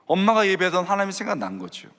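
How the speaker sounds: usual speaking voice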